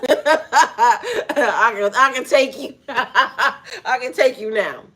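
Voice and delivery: loud, laughing through the words; cocky laughing taunt. Acted. Onlooker thinking he could beat the big man, laughing confidence. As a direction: crack up while sizing someone up. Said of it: "you was thinking".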